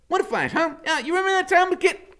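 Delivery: high, loud, rushing; high giddy woman. A narrator's reading. Loretta reminiscing to Iggy, high and fast. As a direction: chatter on eagerly.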